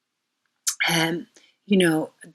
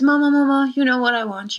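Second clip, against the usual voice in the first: higher, louder calling to mother; pleading daughter; 7 semitones higher, 4 dB louder, faster than usual. Retold or imitated. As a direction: beg mama for what you want. Parvati pleading with her mother.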